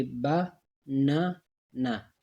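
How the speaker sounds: "बा ना ना" slowly, as three separate pieces; split into syllables. Shown as a demonstration. Teacher giving three individual parts, "बा ना ना" syllable by syllable. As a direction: pause between "बा", "ना" and "ना". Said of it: "individually three word हमें देते हैं".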